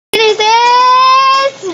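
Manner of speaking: drawn out high and loud, slowly; mock amazed slow question, high and loud; mock dramatic question.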